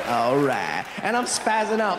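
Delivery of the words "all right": low relaxed Texan drawl; McConaughey impression. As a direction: lower slow drawled all right. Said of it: "his accent's the same".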